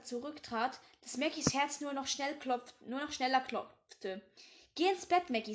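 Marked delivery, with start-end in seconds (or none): 4.7-5.5 firm order. Acted. Father sends daughter to bed.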